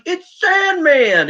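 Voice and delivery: high, drawn-out excited announcer cry; Joey. Retold or imitated. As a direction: imitate a commentator hailing a surprise entrance. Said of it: "Joey's like".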